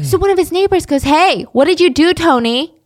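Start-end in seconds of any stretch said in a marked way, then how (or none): 1.0-2.7 raised voice across a yard; calling out. Retold or imitated. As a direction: call out to a neighbor, loud. Neighbor calls out across fence.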